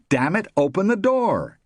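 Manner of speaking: loud, high, angry call; exasperated demand. A narrator's reading. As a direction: shout through a closed door in frustration. Shut-out husband calls from outside.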